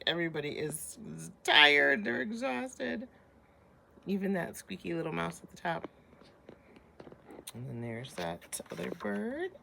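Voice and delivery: plain reading voice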